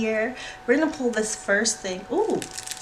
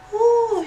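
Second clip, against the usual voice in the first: excited squeal; 7 dB louder than usual. Real, not acted. Squeal over confetti.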